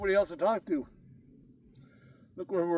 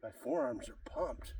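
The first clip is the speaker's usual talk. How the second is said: breathless whisper; 5 semitones lower, 9 dB quieter, slower than usual. Real, not acted. Tired mid-workout between sets.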